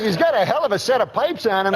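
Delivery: put-on high, chummy old ballplayer voice; Uecker impression. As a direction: pitch it up like a folksy baseball announcer. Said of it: "I just love those Euchre impressions".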